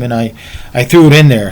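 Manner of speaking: usual speaking voice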